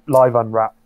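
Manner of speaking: usual speaking voice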